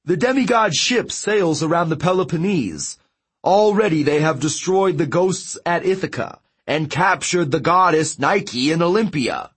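high-pitched messenger reporting to his king; giant messenger. Acted. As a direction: deliver the report high and urgent.

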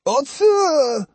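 very high, drawn-out name call. A narrator's reading. Old Osugi calling after Otsu from nearby, high cry. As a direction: call her name from a distance. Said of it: "came a reply from an unexpected quarter".

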